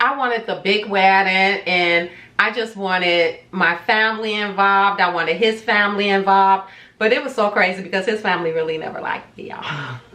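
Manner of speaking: usual speaking voice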